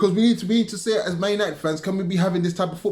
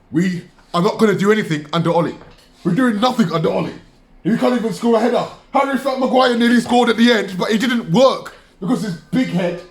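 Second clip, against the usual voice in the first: loud frustrated venting; fed-up fan shouting; angry; 6 semitones higher, 6 dB louder than usual. Real, not acted. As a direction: hammer it out in real anger. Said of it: "I'm mad".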